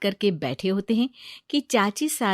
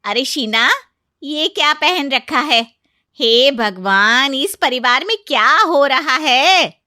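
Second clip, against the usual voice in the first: scandalized yelling; 5 semitones higher, 9 dB louder than usual. Acted. Aunt-in-law sees the bride's suit and yells, scandalized. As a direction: cry out in outrage. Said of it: "इतना क्यों चिल्ला रही हो".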